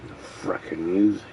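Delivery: low, soft, quick grumble; low mutter. Real, not acted. Fed up with a song in his head, low quick mutter. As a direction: mumble it down under your breath.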